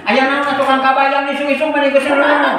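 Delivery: high, unvaried nagging voice; nagging wife. Shown as a demonstration. Wife nagging Kabayan in the morning, high chattering scold. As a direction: nag on one high pitch all through. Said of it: "istri bawel".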